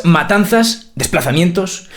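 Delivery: ordinary talk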